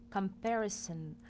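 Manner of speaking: ordinary delivery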